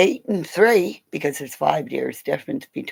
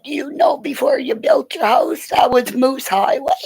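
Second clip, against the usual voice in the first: excited; 10 semitones higher, 6 dB louder than usual. Retold or imitated. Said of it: "I said".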